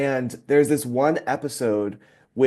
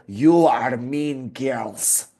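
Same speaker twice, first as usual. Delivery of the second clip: put-on Romanian accent; much slower than usual. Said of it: "Romanian type of accent".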